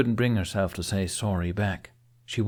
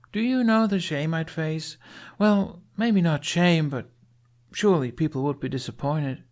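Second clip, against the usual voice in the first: put-on higher, earnest young warrior woman; Astrid's voice; 8 semitones higher than usual. A narrator's reading. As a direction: boast a little, proud but unsure.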